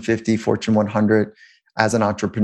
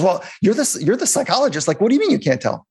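coach's challenge voiced higher, a touch mock-incredulous; retold incredulous coach; 9 semitones higher than usual. Coach pressing the psychologist before kickoff, higher and mock-incredulous. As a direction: pitch it up like the coach who can't believe the answer.

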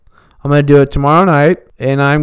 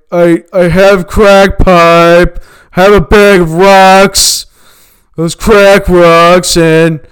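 higher and louder, a panicky jittery friend; frantic crackhead voice; 5 semitones higher, 9 dB louder than usual. Retold or imitated. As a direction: play the crackhead pleading that he has to leave, all nerves. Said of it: "He's like".

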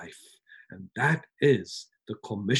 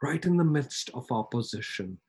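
ordinary talk

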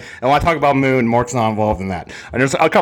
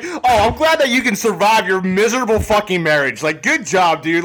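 raised pitch, mocking praise; sarcastic congratulations; 7 semitones higher than usual. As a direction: congratulate them as if it were no achievement. Sarcastic reply to married people's posts.

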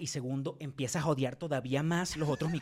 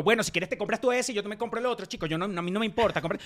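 annoyed retort; 6 semitones higher, 4 dB louder, faster than usual. Retold or imitated. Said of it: "No te lo dije en ese tono".

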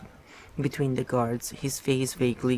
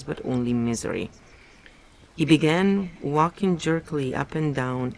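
plain reading voice

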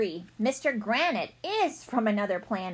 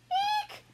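squeal of disgust. Acted. Narrator kid frightened by idea.

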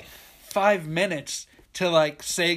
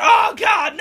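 very high, loud scream; imitated hysterics; 11 dB louder, slower than usual. Woman losing it down at dock, high mock scream. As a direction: wail as if losing it completely. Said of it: "she's losing her shit down there".

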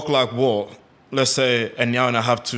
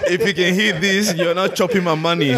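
higher, laughing jokey aside; 6 semitones higher than usual. Real, not acted. Father jokes to his kids listening, laughing.

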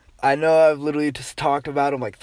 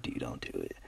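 hushed plea; 21 dB quieter than usual. Retold or imitated. Pleading under breath to teacher.

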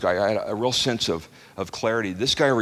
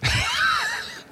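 high whooping laugh. Real, not acted. Joking about a fun church.

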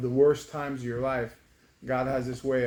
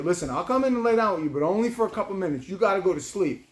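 raised pitch, firm bedtime instruction; mildly exasperated parent, a bit louder; firm dad; 6 semitones higher, 4 dB louder than usual. Retold or imitated. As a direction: lay down the rule to a child. Hectic, firm talk to child.

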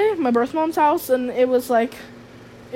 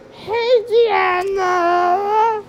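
much higher, chirpy mock greeting; high sing-song friend; 7 semitones higher, much slower than usual. Friend greeting Deanna, mock chirpy voice. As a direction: imitate an overeager friend saying hi.

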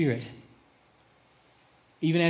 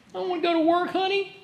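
high whine, pushed out a bit louder; whining husband; 4 dB louder, faster than usual. Retold or imitated. Husband whines to his wife about work, high mock whine. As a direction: sulk like a husband who won't go in. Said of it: "Your husband ever cried to you".

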